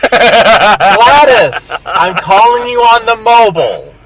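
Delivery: put-on high, loud, laughing old lady on the phone. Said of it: "'Cause I'm an old lady".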